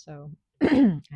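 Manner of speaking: quick throat clear mid-word; dry cough. Real, not acted. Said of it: "My voice is a little bit itchy".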